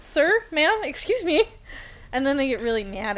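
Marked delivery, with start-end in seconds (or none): 0.0-1.4 high, indignant sir ma'am; offended customer. Acted. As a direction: act put out and huffy.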